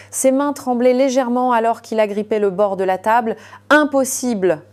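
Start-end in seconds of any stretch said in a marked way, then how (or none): none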